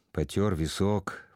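plain reading voice

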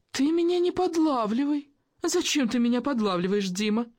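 high-pitched, offended plea. A narrator's reading. Actress accusing the journalist of trapping her, hurt protest. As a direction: protest high, part threat, part appeal for pity. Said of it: "Она повысила голос. В нём прозвучали одновременно и обида".

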